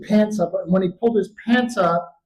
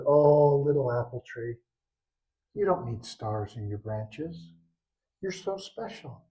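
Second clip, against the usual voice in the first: low, soft, tender reassurance; great creator; 4 semitones lower, 8 dB quieter than usual. Acted. Great creator comforting the little apple tree, soft and tender. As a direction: console gently in a hushed voice. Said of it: "And the great creator said".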